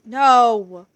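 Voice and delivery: sudden loud shout of protest; furious real shout. Real, not acted. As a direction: yell NO at the attacker. Shouting at attacking mob.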